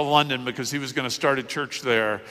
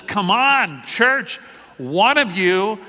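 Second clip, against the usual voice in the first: high, slow exasperated appeal; exasperated scolding; 5 semitones higher, much slower than usual. Acted. Paul to the Corinthians about a scandal, exasperated. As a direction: call them out in disbelief, drawing it out.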